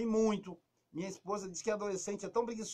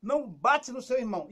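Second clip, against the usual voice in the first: mock scolding; 4 semitones higher, 7 dB louder than usual. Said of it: "Ou então".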